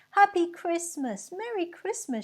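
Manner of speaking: high, loud cheerful shout; crowd shouting back. A narrator's reading. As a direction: fling it back gaily. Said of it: "shouted back the crowd".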